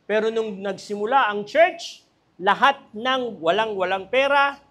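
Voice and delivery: rally the congregation; fervent sermon build; preaching. Acted. Preacher builds on early church.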